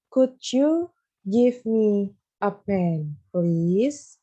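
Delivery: Could you give me a pen drawn out very slowly; very slow, careful teacher read; very slow model reading. Shown as a demonstration. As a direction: stretch every word for the class to follow. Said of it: "Miss bacain ya".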